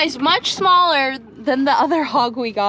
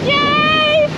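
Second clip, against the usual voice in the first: excited cheer; 12 semitones higher, 5 dB louder than usual. Real, not acted. Cheering on return.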